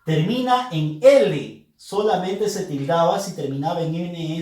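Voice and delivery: ordinary talk, nothing marked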